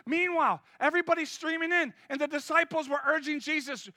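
usual speaking voice

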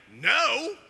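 loud, high, acted exasperation; disciples' frustrated no. Tired fishermen who caught nothing all night, acted frustration. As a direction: throw it back loudly, fed up. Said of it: "their no was probably like".

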